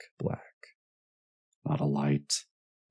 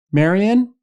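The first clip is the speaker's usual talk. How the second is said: very high, loud rising call; insistent raised-voice call; 11 dB louder than usual. A narrator's reading. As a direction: call the name, wanting an answer. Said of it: "called Louise".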